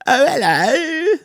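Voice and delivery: very high, slow sing-song oh hello; mock posh greeting. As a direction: stretch the oh way up like a hammy toff. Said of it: "talking like that the whole time".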